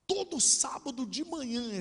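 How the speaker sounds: high, slow lament; drawn-out plaintive cry to the judge; retold tearful plea. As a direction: wail it lightly, recalling breaking down. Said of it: "eu desabei a chorar igual uma criança".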